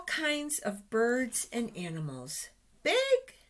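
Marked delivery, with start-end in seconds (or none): 2.8-3.4 big high voice. Acted. Reading contrasts big and small.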